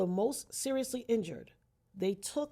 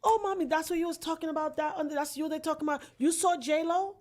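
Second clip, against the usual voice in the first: fast, high-pitched gushing, slowing on the question; imitating a loud, excited nurse; 7 semitones higher, 5 dB louder than usual. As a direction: gush like a starstruck nurse. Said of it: "She comes to me and she said".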